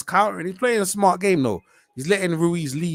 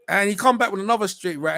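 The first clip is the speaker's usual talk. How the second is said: ordinary delivery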